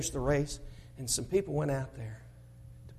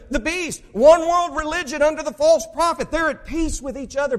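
higher and louder preacher's cry; preaching; 11 semitones higher, 9 dB louder than usual. Acted. Preacher warning congregation about the beast, fiery and loud. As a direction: proclaim the end times.

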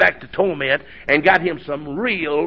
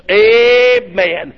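high ringing amen; shouted amen; 14 semitones higher, 4 dB louder than usual. Real, not acted. Preacher crying amen to the church.

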